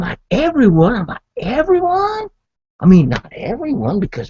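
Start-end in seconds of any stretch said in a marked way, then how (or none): 0.3-0.9 high, loud questioning exclamation; surprised outburst, voice raised; incredulous. Acted. Repeated mock-incredulous quote. 1.4-2.3 very high-pitched, loud squeal of disbelief; hammed-up squeaky amazement; more incredulous. Repeated quote, pitched higher.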